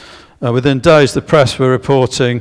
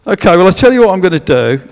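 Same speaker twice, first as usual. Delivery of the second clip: higher, louder auctioneer calling for bids; 8 semitones higher, 5 dB louder than usual. Pretend. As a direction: push the room for a bigger bid. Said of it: "I'm sound like an auctioneer here".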